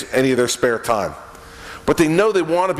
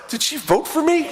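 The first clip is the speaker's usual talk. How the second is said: comic doubt; 14 semitones higher than usual. Retold or imitated. Comic inner doubt about wife.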